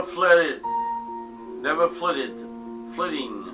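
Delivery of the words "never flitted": ordinary delivery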